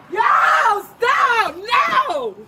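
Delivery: frantic shouts to stop; shouting. Real, not acted. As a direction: yell in real distress. Struggling during arrest, shouting.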